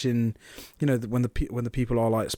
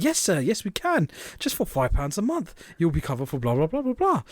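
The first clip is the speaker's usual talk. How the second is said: higher, sing-song mock saleswoman; bad advert acting; 3 semitones higher than usual. Saleswoman pitches cover to the caller, badly acted advert voice. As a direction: overdo a chirpy call-centre pitch. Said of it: "This is the worst acting".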